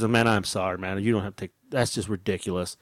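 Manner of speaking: low, soft commiseration; sympathetic apology. Retold or imitated. Shopper commiserating with mistreated cashier, sympathetic. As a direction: console him quietly, man to man.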